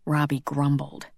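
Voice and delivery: plain reading voice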